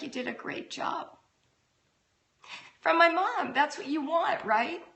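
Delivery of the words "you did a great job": a mother's quiet praise in the hallway; mother gently quoting her own mom's praise; soft praise. Retold or imitated. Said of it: "She's like".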